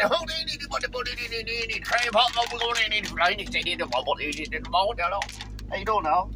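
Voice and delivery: high, hammed-up Tillman's Corner jabber; high put-on Tillman's Corner accent; local Tillman's Corner accent. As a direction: imitate half-garbled local Tillman's Corner talk. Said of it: "I started speaking in it to him".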